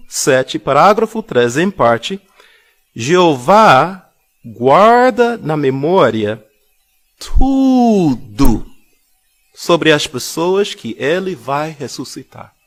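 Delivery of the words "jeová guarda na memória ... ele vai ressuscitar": slow reading. Shown as a demonstration. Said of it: "Esta declaração é muito profunda".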